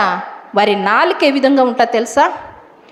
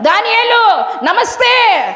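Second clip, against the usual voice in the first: higher, slow, syrupy hammed-up greetings; mock loving greeting; 6 semitones higher than usual. Plotters greeting Daniel with fake love, sweetly. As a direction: play the two-faced friend, sugary and drawn out. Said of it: "అని బాగా ప్రేమతో మాట్లాడుతున్నారు".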